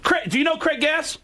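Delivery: high, calling across a dinner table; Richard Belzer. Retold or imitated. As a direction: call them over, pitched well up. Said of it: "Belzer stands up and he".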